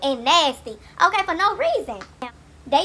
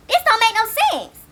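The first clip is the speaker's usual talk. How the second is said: high, slow, disbelieving drawl; exasperated; 8 semitones higher, 4 dB louder than usual. Real, not acted. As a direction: say it in pure disbelief. Exasperated disbelief at family ages.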